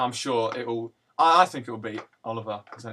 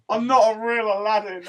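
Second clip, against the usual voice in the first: mock whine; 9 semitones higher, 7 dB louder than usual. Mocking friend's self-pity.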